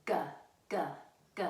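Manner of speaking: a hard "G" sound, said alone; letter G sound. Shown as a demonstration. Librarian showing children the letter sound "G". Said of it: "Can you say it with me?".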